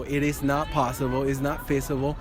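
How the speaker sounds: ordinary delivery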